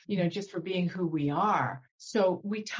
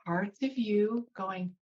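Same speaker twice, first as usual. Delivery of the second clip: ordinary talk, nothing marked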